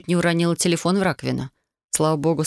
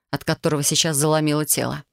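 usual speaking voice

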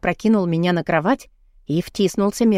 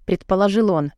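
ordinary delivery

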